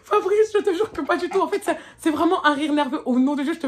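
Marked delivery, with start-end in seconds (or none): 0.0-1.4 high-pitched, pleading denial; retold high, flustered denial; flustered protest. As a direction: protest your innocence, high and louder. Protesting to Fabrice while laughing nervously.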